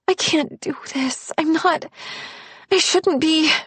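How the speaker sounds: mild fretful rising worry; anxious panic. Acted. Woman panicking to her boyfriend after meeting his family.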